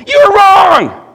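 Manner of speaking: high, full-force drawn-out yell; outraged yelling. Retold or imitated. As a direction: yell back at the radio in protest. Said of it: "I was yelling in a tractor cab".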